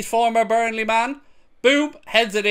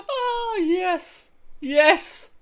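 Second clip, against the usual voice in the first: gleeful yes; 11 semitones higher, 3 dB quieter, much slower than usual. Real, not acted. Relishing the fourth goal.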